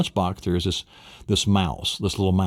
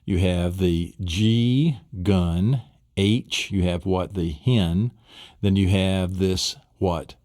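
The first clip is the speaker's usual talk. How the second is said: ordinary talk, nothing marked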